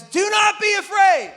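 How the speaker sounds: shouted high command to a frightened crowd; Moses shouting. Acted. Moses to the terrified Israelites, a loud high shout. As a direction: bellow it out over the panic. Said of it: "and he says".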